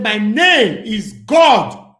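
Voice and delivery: loud, very slow declaration; mock boast. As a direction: drag out each word like a proclamation. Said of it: "He said".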